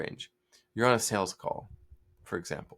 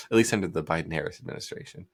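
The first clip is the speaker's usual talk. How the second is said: ordinary delivery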